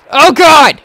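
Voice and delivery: high, loud cry of alarm; panicked shout. Real, not acted. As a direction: yell out as you get cornered. Cornered in the game.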